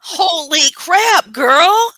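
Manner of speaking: slow, high, drawn-out disbelief; astonished reaction. Real, not acted. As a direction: stretch each word in amazement. Listener reacts to fire story.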